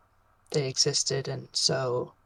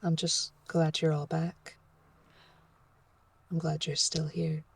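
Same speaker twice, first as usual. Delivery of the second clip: softly comforting; 7 dB quieter than usual. Acted. Professor comforting Arata after a hug.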